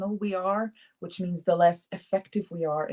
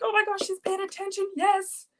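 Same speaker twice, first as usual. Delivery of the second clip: much higher gushing joy; thrilled; 13 semitones higher than usual. Retold or imitated. Host recalling a student using yellow, high delighted gush. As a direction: cheer like a proud teacher. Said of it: "I was like".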